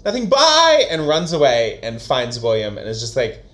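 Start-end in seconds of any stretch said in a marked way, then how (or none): 0.0-0.9 higher, louder quick goodbye; hasty escape. Retold or imitated. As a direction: blurt it and flee. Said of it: "Augustus is like".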